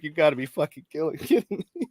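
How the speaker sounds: laughing through the words, rising high; retelling the win, laughing at the end; laughing disbelief. Retold or imitated. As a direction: crack up mid-sentence. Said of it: "dying laughing inside".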